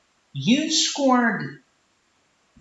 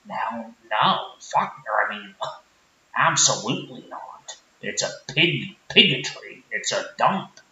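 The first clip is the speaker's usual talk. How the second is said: low-pitched sheepish small-town guy; Rick character; 8 semitones lower than usual. Acted. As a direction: mumble it lower like a shy country guy. Rick dismisses New York.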